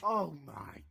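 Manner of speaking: ordinary talk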